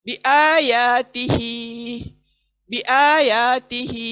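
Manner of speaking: बिआयातिही with आ, या and ही long, बी and ती quick; slow tajweed reading of बिआयातिही; drawn-out recitation. Shown as a demonstration. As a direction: stretch आ, या and ही in बिआयातिही. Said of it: "आ खींचना है, या खींचना है".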